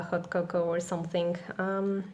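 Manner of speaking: ordinary talk, nothing marked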